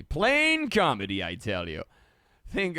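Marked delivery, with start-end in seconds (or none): 0.0-1.2 high, louder, slow exclamation; belting the verdict; emphatic proclamation. Shown as a demonstration. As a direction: announce it like a punchline. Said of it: "repeats the phrase twice".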